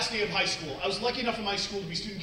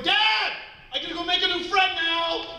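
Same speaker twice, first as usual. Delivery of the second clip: put-on giddy child's yell, pitched way up; excited little kid; 10 semitones higher than usual. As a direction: call it out like a thrilled small child. Little daughter calling back to dad.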